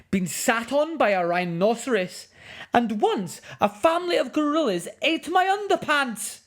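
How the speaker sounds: high, loud, indignant man scolding; furious filmmaker. Acted. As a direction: tell off the naughty cub in outrage.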